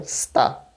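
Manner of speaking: "sta" with a clean hissed S before T; plain S. Shown as a demonstration. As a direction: keep the S in "sta" sharp, not SH. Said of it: "vai continuar sendo S".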